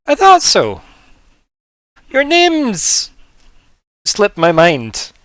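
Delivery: high, loud, stretched-out words with pauses; forgetful sloth. Acted. Forgetful sloth addresses the players.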